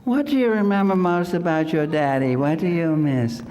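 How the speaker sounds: a put-on sweet interviewer voice; mock interviewer. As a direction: coo at the little girl like a TV host. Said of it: "and she says".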